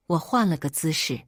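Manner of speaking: ordinary delivery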